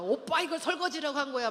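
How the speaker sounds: loud, high mock scolding; acted nitpicking at full volume; scolding wife. Imitated wife finding fault with husband's dishwashing, loud scolding. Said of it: "타박을 하는데".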